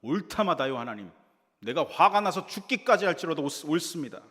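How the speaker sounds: loud, high defiant retort; defiant shout. Acted. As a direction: shout back at God in defiance. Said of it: "이렇게 더 대들고 있죠".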